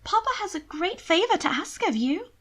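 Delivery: high, coaxing sister voice; wheedling. Acted. Sister sweet-talking her twin into a favour, wheedling. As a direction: coax him into a favour, sweetly. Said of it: "with wheedling intent".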